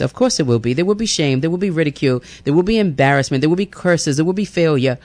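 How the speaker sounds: normal talking voice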